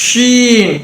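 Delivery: ஷீன் begun with a long rush of sh; air-first sh. Shown as a demonstration. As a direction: push air out, then a long 'eeen' in ஷீன். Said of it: "காத்த முத வெளியே கொண்டு வந்தோன்னா".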